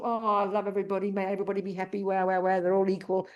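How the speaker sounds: hammed-up sentimental drone on one even pitch; mock emotional gushing. Teacher mocking sentimental meditators who love everybody, gushy monotone parody. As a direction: run it together in a mock wail of universal love. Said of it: "This is not an emotional".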